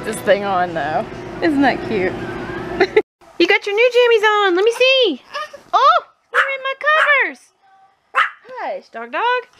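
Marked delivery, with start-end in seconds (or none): 3.4-9.5 put-on high, fast doggy baby talk; baby talk to pet. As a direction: coo at the dog like a baby. Dad baby-talks to dog.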